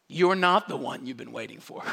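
normal talking voice

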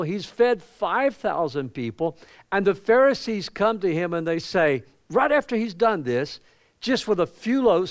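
preacher climbing into the story; fired-up preaching. Acted. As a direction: lift it into the pulpit voice. Preaching builds up.

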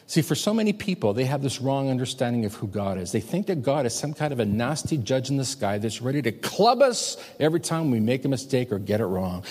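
ordinary talk

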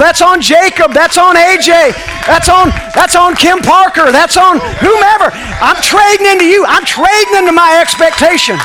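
loud, high preaching chant; full-force preaching. Acted. Preaching at full force, call and response.

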